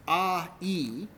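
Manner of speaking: "ah, e" as two drawn-out sounds; two vowels, one after the other; separate vowels. Shown as a demonstration. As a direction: say "ah, e" with each vowel distinct. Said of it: "if you keep saying it".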